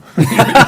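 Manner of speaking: a sudden, high, loud laugh; burst of high laughter. Real, not acted. Host laughing at the boy's answer, loud and delighted.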